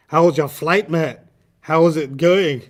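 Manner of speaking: loud, lively imitation of a Scottish accent. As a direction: greet them in a booming mock Scottish accent. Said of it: "He, he really sounded Scottish".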